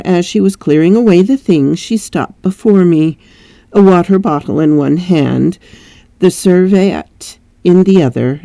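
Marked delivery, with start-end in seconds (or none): none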